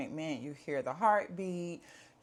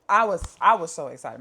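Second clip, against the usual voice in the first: bursting out much louder, a little fast; excited; 11 dB louder, faster than usual. Real, not acted. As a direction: let the excitement spill out loudly.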